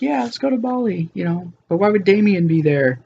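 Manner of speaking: high, lightly mocking Felicity voice; light high-pitched girlfriend impression; voicing Felicity. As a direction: play her up high and breezy. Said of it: "She's like".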